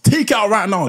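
angry dad. Retold or imitated. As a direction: bark it like an angry father. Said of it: "when they're angry".

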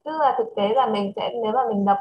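ordinary talk

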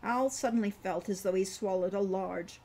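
ordinary delivery

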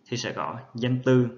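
ordinary talk

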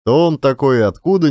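ordinary delivery

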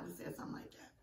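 hushed whisper in a theater. Retold or imitated. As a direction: whisper so only your friend hears. Give a good whisper.

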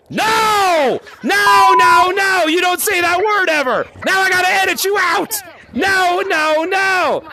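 sharp high yelling no; angry shout. Real, not acted. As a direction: shout him down for the bad word. Scolding a kid's bad word.

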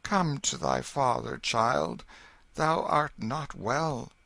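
king soothing his son; gentle voice. Acted. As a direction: coax the sick child close. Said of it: "said in a gentle voice".

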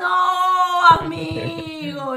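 loud, drawn-out cry of dismay; dismayed shout. Real, not acted. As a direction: shout it long, teasing a rejected friend. Mock dismay at friend's rejection.

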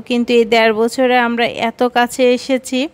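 usual speaking voice

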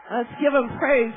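ordinary talk

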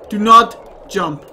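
loud and slow warning; mock-dramatic command to stop; slow, loud begging; firm loud plea. Pleading with jumper character.

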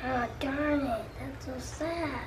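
talking around a pencil in mouth; pen in teeth. Real, not acted. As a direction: speak with a pen between teeth. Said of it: "there's a pencil in my mouth".

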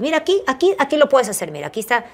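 frantic coaxing; urgent pointing. Retold or imitated. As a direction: push her toward the edge. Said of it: "Y automáticamente".

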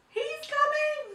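very high, loud, slow shriek. A narrator's reading. Mouse warning everyone, high panicked shriek. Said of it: "shrieked the mouse".

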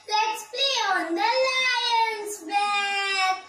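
drawn-out singsong cheering; playful glee. Acted. Mouse romping on the lion's back, slow playful singsong. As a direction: stretch it out like a gleeful game.

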